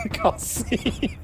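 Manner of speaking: high squealing giggle and call; cute anime squeal. Acted. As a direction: giggle then squeal the name.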